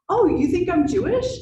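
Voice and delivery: high, loud surprised reply; surprised question. Retold or imitated. Answering the musician's wife, loud high surprise. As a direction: pop it out in happy disbelief.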